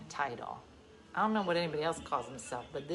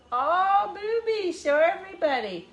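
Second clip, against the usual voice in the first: put-on baby talk to dog; 13 semitones higher, 4 dB louder, slower than usual. Talking to her dog Ruby.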